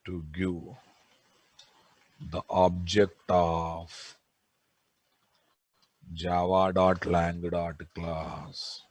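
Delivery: slow, soft dictation for note-taking. Acted. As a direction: read it out word by word, slowly. Dictating notes slowly.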